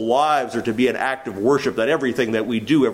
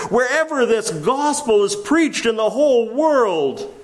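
preaching; 8 semitones higher than usual. Acted. Preaching at full force.

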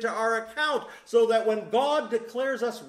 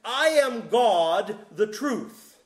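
slow proclamation; 5 dB louder, much slower than usual. Acted. Preacher proclaims Jesus' claim.

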